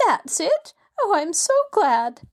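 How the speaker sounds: high, gushing delight; eager joy. A narrator's reading.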